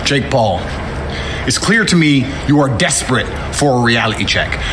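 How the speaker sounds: loud, firm callout delivered straight; formal callout speech. Acted. Fighter calling out Jake Paul, loud firm challenge.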